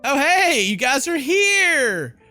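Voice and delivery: usual speaking voice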